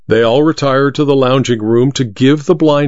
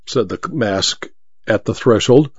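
ordinary delivery, nothing marked

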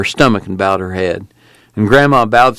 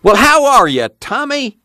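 put-on high, delighted old man's greeting; beaming granddad hello; cheery old Grandpa; 14 semitones higher than usual. A narrator's reading.

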